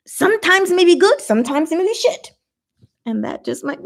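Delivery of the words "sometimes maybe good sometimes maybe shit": sing-song shrug. Pretend. Mock answer to the meme.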